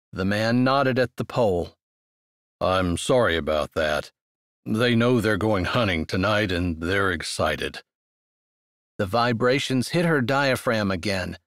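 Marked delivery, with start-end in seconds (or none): 2.6-7.8 lowered, easygoing male voice; low man's voice. Acted. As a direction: apologize in a low, relaxed voice.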